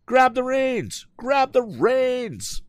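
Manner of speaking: very high, slow stretched shout. A narrator's reading. Tom shouting at the woman to grab the reins, slow high yell. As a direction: drag each word out as you yell. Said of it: "shouted Tom".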